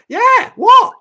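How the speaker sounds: high, loud panicked shriek of "what"; mock panicked yelp; panicked squeal. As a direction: squeal it like you're terrified. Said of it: "I was like".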